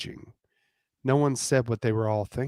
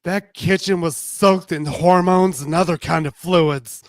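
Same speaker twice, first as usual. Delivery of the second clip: higher, louder gossipy tease; brassy and knowing; Justine voice; 6 semitones higher, 10 dB louder than usual. Acted.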